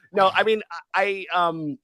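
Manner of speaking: ordinary delivery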